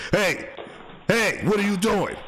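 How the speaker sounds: high-pitched challenge; calling out a thief. Pretend. Host confronting a package thief on video, high calling out. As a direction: holler as if catching them at it.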